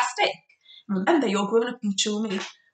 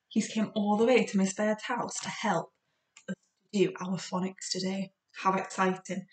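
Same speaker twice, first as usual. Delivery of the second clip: ordinary talk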